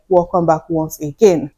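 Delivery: normal talking voice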